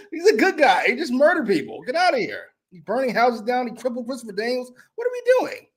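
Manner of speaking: high-pitched amused protest; laughing incredulity. Real, not acted. Mocking the supposed good guy.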